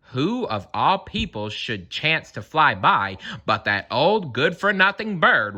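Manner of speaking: ordinary delivery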